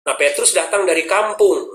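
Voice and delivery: ordinary delivery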